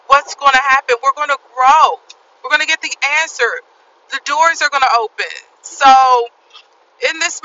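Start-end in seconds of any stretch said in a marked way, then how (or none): none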